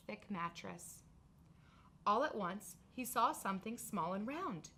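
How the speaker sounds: ordinary delivery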